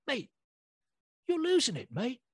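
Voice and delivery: high-pitched mock British dad; imitated British dad. British dad warning his son about toys, imitated British accent. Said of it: "his dad's like".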